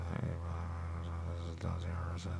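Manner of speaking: whispered to yourself; real sheepish whisper; embarrassed whisper. Real, not acted. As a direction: confess it under your breath. Embarrassed aside to self.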